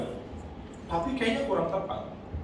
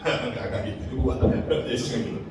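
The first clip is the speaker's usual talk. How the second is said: normal talking voice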